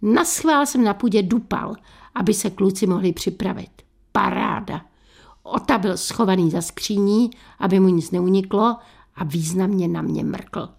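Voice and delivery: usual speaking voice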